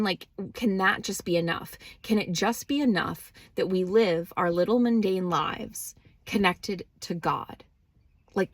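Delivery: normal talking voice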